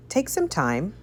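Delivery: ordinary delivery, nothing marked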